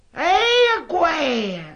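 very high, strained cry of amazement; astonished cry. Acted. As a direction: gape at the impossible. General sees enemies alive.

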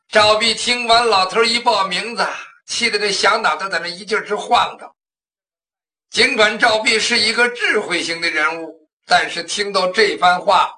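usual speaking voice

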